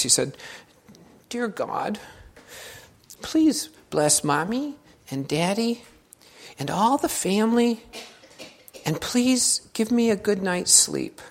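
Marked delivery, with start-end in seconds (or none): 1.3-11.2 put-on soft, high child's bedtime prayer; little boy praying. Little boy praying beside his bed, softly and sweetly. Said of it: "he prayed in his normal voice".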